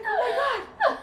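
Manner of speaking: very high, giggly squeal; mock panic. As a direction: squeal it, half laughing. Acting panic when exposed in stall.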